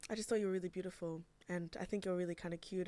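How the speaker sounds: usual speaking voice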